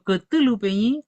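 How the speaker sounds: high, loud, bright praise to a brother; Cthulhu, Peñi, excited approval; cheerful praise. Pretend. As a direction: congratulate him. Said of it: "si yo le digo".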